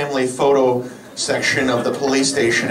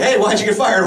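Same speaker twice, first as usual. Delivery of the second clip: mock friend asking; 8 semitones higher than usual. Friends asking a fired guy, high and teasing. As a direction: ask it brightly, half-teasing. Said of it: "So we're like".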